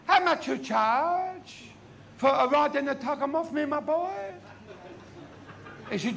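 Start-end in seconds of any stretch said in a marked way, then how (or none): 0.1-4.1 high, drawn-out question, comic character voice. Acted. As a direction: draw out the haggling question, pitched high.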